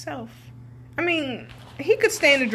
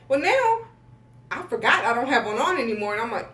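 ordinary talk, nothing marked

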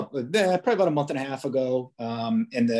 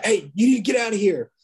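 much higher, mildly raised holler; imitated yell; 9 semitones higher, 4 dB louder, faster than usual. UPS driver ordering an officer away, imitated yell. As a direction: act out someone yelling to go. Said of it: "he yells at me from across the street".

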